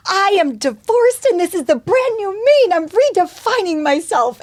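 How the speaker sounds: high, loud, hammed-up proclamation; mock divorcee. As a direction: play a triumphant newly single woman shouting it. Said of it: "woman named Joy who's like".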